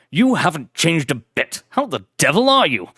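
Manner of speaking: put-on hearty old gentleman greeting a friend; exuberant aristocrat. Blackwood greeting loyal Deeds, exuberant aristocratic voice. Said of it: "his exuberant, aristocratic tones".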